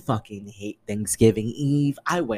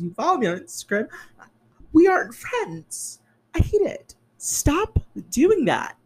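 exasperated rant; 12 semitones higher than usual. Retold or imitated. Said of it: "In my head, I'm like".

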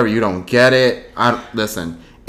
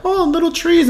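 Bob Ross voice. Retold or imitated. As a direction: coo it like a gentle painter.

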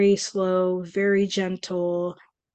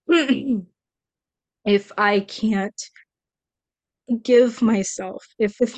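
getting emotional; 3 semitones higher than usual. Real, not acted. Recalling coming back to oneself, emotional. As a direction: push slowly through real emotion. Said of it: "I'm getting emotional".

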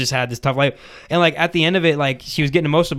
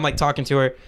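ordinary delivery

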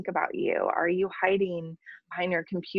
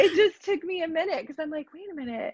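laughing; 13 semitones higher, 5 dB louder than usual. Real, not acted. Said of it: "that's the accent".

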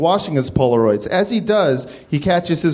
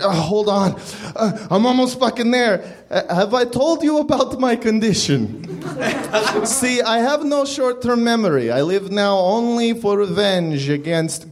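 higher, breathless leer; Johnny's voice; 6 semitones higher than usual. Acted. Johnny tells Leonard about his condition, higher leering voice. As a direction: play the distracted creep, pitched up.

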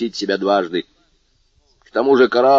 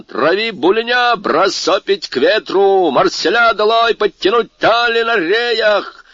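shouted orders; 9 semitones higher than usual. A narrator's reading. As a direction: bark orders at the crew. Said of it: "крикнул капитан".

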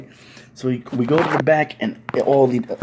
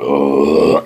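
long, low guttural groan; low groan of pleasure; 13 semitones lower, 3 dB louder than usual. Retold or imitated. Newcomer sucking rib juices, low pleasured groan. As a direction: groan over a juicy bite.

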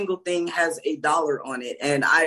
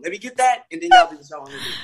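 laughing through a joke, quick and louder; 6 dB louder, faster than usual. Real, not acted. To the co-hosts, joking about needing testosterone, laughing. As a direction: rattle it off while cracking up.

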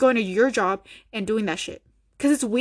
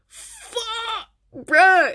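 exasperated outburst; 12 semitones higher, 6 dB louder than usual. Real, not acted. Ranting about ketchup pronunciation.

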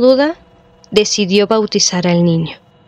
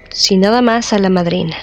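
usual speaking voice

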